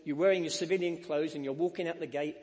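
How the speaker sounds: usual speaking voice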